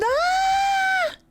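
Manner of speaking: sudden very high shriek of delight; high squeal. Real, not acted. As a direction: squeal with glee.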